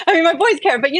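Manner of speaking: correcting herself to her husband, laughing. Real, not acted. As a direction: laugh as you correct yourself.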